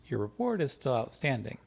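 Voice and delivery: ordinary delivery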